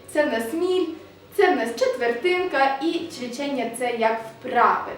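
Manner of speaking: usual speaking voice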